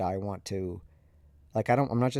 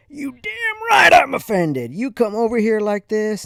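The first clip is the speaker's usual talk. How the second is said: enraged yell; 12 semitones higher, 10 dB louder than usual. Retold or imitated. Father yelling at his son for wearing short sleeves, enraged shout. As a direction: bellow it with wounded outrage. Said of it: "he just starts yelling at me".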